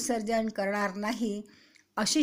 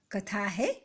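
ordinary delivery

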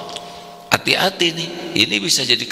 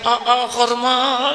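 mock deferential salute; 14 semitones higher, much slower than usual. People saluting an official.

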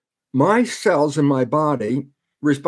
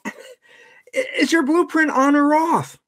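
silly question; 11 semitones higher than usual. Retold or imitated. Mock question to an architect.

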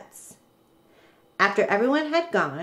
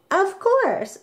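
realization; 11 semitones higher than usual. Acted. Katie figures it out.

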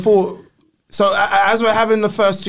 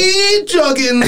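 shout; 10 semitones higher, much slower than usual. Acted.

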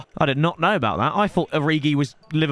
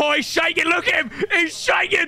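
high, gleeful commentary; excited crowing; 9 semitones higher than usual. Real, not acted. Gamer watching a rattled player, excited crowing.

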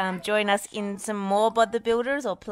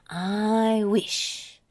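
higher, drawn-out wish; mildly excited; 5 semitones higher, 4 dB louder than usual. A narrator's reading. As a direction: savour the wish with a touch of excitement. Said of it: "said Muck excitedly".

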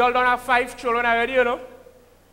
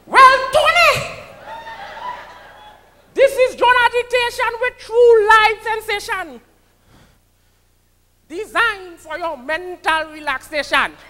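much higher, louder calypso-style intro; John Agitation impression; 10 semitones higher, 4 dB louder than usual. Comedian introducing himself to the crowd as John Agitation, loud and high. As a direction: belt it out like a calypsonian announcing himself. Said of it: "That is my boy".

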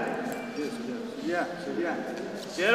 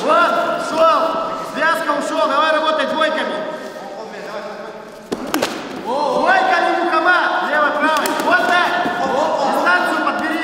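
shouting from the corner. Real, not acted. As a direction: bark instructions over the crowd. Corner coach yelling at his fighter.

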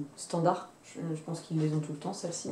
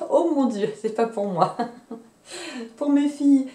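laughing and a bit embarrassed, a little louder; 6 semitones higher, 6 dB louder than usual. Real, not acted. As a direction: blurt it out through a giggle. Laughing at her own fabric order. (en riant, un peu gênée)

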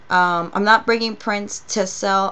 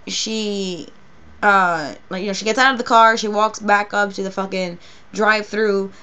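normal talking voice

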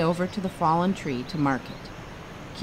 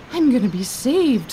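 high, delighted thought; 7 semitones higher, 6 dB louder than usual. A narrator's reading. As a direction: glad relief bursting out. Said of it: "thought Kino delightedly".

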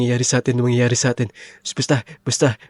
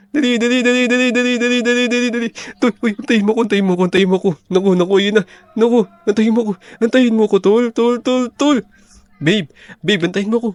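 much higher, breathless panicky urging; frantic hurry; 9 semitones higher, faster than usual. Acted. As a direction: rush them along in mock terror. Fleeing, urging and pleading. (nagmamadali at takot, mataas ang boses)

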